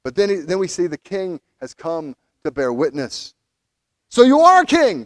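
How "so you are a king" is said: a hint of a mocking Pilate, louder and higher; half-voiced Roman governor pouncing. Pilate confronting Jesus, lightly mocking. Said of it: "Pilate says".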